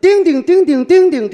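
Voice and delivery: put-on child babbling. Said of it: "这孩子说这个".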